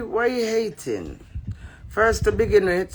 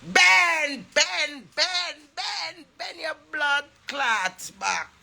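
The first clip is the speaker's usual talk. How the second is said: calling out; 9 semitones higher, much slower than usual. Real, not acted. Calling out Ben.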